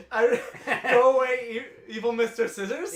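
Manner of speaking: laughing while repeating the phrase in disbelief. Real, not acted. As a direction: crack up as you say it.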